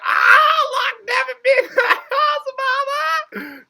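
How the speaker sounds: very high, loud, delighted shriek; fangirl squeal. Retold or imitated. Viewer gushing over Devin to mama, fangirl squeal. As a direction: squeal with laughter breaking in.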